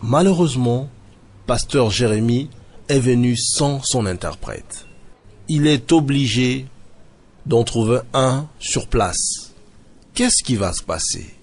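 reporter narrating a church visit; measured documentary narration; news report voiceover. Acted. Reporter introduces the visiting pastor, in a documentary voiceover.

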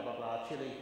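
ordinary talk, nothing marked

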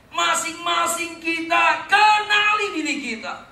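high, loud, slow half-sung cry; preaching at full force. Acted. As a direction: proclaim it to the whole church. (berkhotbah dengan suara keras, pelan dan setengah bernyanyi)

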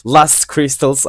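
"Lost Crystals" slow, with an English accent and the o opened toward "last"; mock-fancy English accent on "Lost Crystals". Shown as a demonstration. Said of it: "güzel bir İngilizce aksanıyla söyleyeyim".

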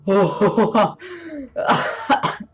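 shaky relieved laughter after a scare; high nervous laugh. Real, not acted. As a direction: laugh off the fright.

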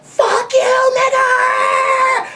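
high, loud angry yell out a car window; high-pitched furious yell; retold driver's yell. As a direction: shout it like a road-raging driver. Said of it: "yell out their window like".

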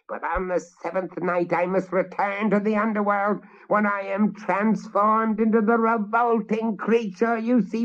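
high hag voice, slowing on human form; vengeful witch. Acted. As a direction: pronounce doom like a cursed queen. Witch speaks at length.

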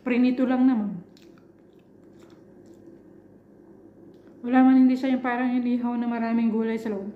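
pausing to chew food; talking while chewing. Real, not acted. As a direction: mouth partly full. Seen 2: Jaw chews, lips closed between words; fish pieces in hands throughout.

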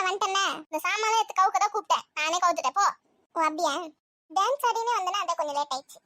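ordinary talk, nothing marked

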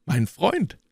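high, glad call; cried out. A narrator's reading. D'Artagnan calls to his friend, crying out. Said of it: "schrie D'Artagnan".

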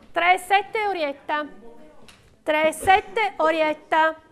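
steady rhythmic bid calling; auctioneer calling bids. Real, not acted. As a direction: call out each bid to the room.

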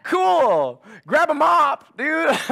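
high, loud mock-cool dad retort; deadpan it, then hand him the mop; sarcastic high loud dude. Dad's sarcastic reply to son.

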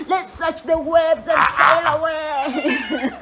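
slow hearty pirate shout. Acted. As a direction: bellow it like a pirate captain. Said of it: "the pirate is gonna speak in a pirate voice".

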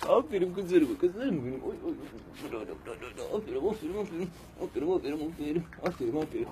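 high sweet praise for puppies; talking to a dog. Real, not acted.

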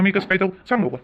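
usual speaking voice

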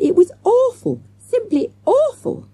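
high, loud melodramatic wail; shrieked. A narrator's reading. Rescued girl telling her ordeal, shrieked. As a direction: draw out the drama in a shrieking high voice. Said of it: "shrieked Jessica".

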